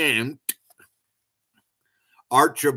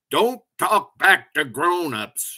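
higher-pitched scolding, only a touch of yelling; mild yell; 6 semitones higher, 4 dB louder than usual. A narrator's reading. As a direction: tell the children off with an understated narrated yell. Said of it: "yelled the Queen Ant".

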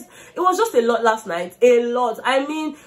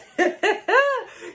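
high burst of laughter; 11 semitones higher than usual. Real, not acted. Laughing at Wande's slow serving.

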